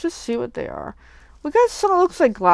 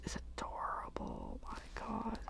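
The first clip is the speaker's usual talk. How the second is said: hushed delighted whisper; 22 dB quieter than usual. Real, not acted. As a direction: breathe it out in awe. Gushing over cute craft dies.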